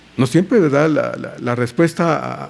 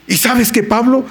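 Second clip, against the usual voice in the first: excited news; 7 semitones higher, 6 dB louder than usual. Retold or imitated. Tito excitedly reporting to Pablo.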